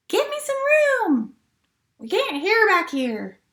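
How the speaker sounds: high, complaining voice in the crowd. Acted. Storyteller voicing a crowd member, high complaining. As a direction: squeak out a pushy crowd member.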